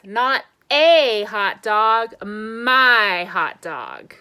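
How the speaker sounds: a slow, insistent pigeon claiming "my hot dog". Acted. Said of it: "Pigeon says".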